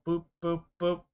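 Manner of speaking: high little "boop" noises; playful boops. Real, not acted. Streamer following a small victory, playful boops. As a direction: pop out three quick, high boops.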